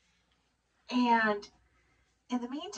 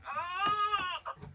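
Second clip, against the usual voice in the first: strangled cry; 13 semitones higher, 6 dB quieter than usual. Retold or imitated. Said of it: "she's just like".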